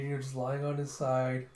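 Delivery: normal talking voice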